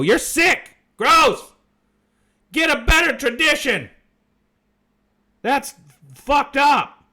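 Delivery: loud, high-pitched heckle; mock outraged shout. Host showing viewers how to scold tradition-followers, shouting. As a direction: yell it in exaggerated disgust.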